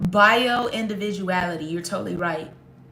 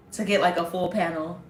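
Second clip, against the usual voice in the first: usual speaking voice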